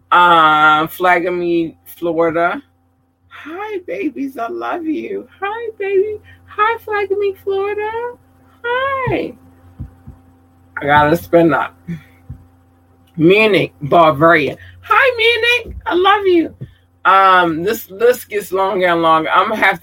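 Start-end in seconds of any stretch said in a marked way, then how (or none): 3.3-9.4 very high, soft squeaky hello. Real, not acted. Gushing greeting to fans. 14.8-16.5 very high-pitched loving hello; gushing high greeting. Real, not acted.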